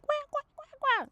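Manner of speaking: high, loud goose quacks; squawking mock goose calls; imitating geese. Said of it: "they start to be like".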